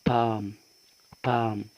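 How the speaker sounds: "بالم" as "paam", a long a with no L; silent L. Shown as a demonstration. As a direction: repeat "بالم" as a model, L left silent. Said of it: "لا، اسمه".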